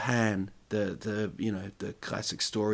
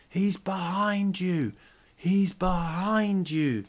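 high, slow sing-song call; kids shouting out; 8 semitones higher, much slower than usual. Retold or imitated. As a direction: call it out slowly like panto kids. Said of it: "Kids are shouting out stuff like".

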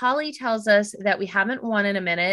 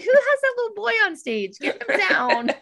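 laughing mock heckler; 12 semitones higher than usual. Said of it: "I could have been like".

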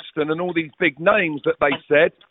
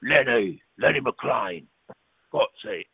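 low, gruff imitation of the hard man; lower, heavier voice of the big East End hard man; Lenny McLean; 6 semitones lower, 4 dB quieter, slower than usual. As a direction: drop the voice low and heavy to introduce yourself. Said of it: "He said".

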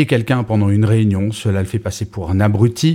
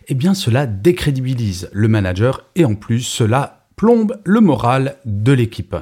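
usual speaking voice

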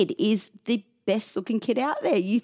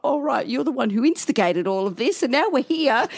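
high, loud indignant telling-off; imitated angry mum; 9 semitones higher, 4 dB louder than usual. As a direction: act out mum rounding on gran, building higher. Mum scolding gran, acted out.

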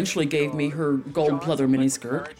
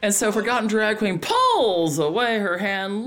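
ordinary talk

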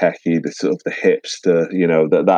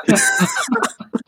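high laugh. Real, not acted. Laughs at own denture joke.

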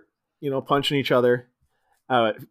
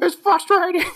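very high parroting falsetto; Popo; 4 dB louder than usual. Acted. Host as Popo echoing Fink's complaint, high falsetto. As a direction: squeak it like a mocking puppet. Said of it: "Popo's like".

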